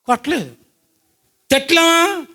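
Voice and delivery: ordinary talk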